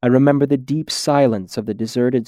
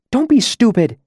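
high-pitched scolding character voice; exasperated objection; 11 semitones higher than usual. Acted. Green-masked demon objecting to her friend's plan, exasperated.

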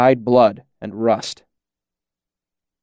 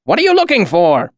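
raised, irritable question; impatient and higher-pitched; testy demand; 8 semitones higher, 4 dB louder than usual. A narrator's reading. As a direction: demand an answer from a rambling man. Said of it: "Cobb demanded testily".

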